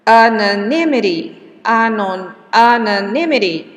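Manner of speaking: Anonymity said loud with the NY syllable stressed; stress demo. Shown as a demonstration. Teacher to class, loud stress demo of Anonymity. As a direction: punch the NY in Anonymity. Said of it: "The stress is here".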